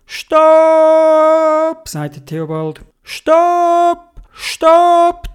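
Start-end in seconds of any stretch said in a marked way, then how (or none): 0.0-1.9 very high, loud, long-held "stop"; urgent stop call. Acted. Theobald halts the speeding sled, urgent high shout. 3.0-5.3 two high, drawn-out halting shouts; urgent stop calls. Acted. Theobald halts sled by a big stone, urgent high calls.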